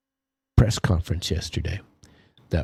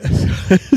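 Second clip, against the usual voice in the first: a sudden loud yawn breaks in; loud real yawn mid-sentence; big yawn; 12 semitones higher, 9 dB louder than usual. Real, not acted. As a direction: let out a big open-mouthed yawn. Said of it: "sorry to be yawning on you".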